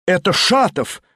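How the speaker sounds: surprised hostess calling. A narrator's reading. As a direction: call after him across the room. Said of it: "крикнула хозяйка".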